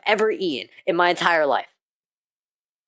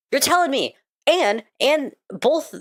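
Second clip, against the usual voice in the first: outraged rant; 8 semitones higher than usual. Real, not acted. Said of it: "Sorry, rant over".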